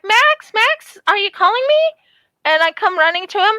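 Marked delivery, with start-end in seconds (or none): 0.0-1.9 high, loud call to the cat. Retold or imitated. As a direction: call out to your cat, higher and louder. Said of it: "I'm like".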